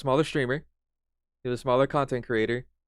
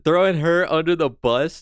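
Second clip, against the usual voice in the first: louder, higher incredulous question; loud disbelief; 5 semitones higher, 5 dB louder than usual. Real, not acted. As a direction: throw the phrase back in shock. Reacting to clip claim.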